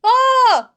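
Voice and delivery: shrill high attack yell, hammed up; high shout. Wife throwing cold water at naked husband, hammed-up high yell.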